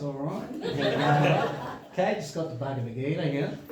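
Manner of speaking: a hint of broad Australian, relaxed; Australian accent. Showing the audience his old Australian accent. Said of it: "I was really talking like this".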